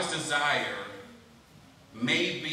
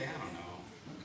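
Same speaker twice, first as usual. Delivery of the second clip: much softer and lower, slow mumble; lower, quiet reluctant mumble; mock shrug; 9 semitones lower, 16 dB quieter, much slower than usual. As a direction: mumble it like you don't care. Imagined listener shrugs it off.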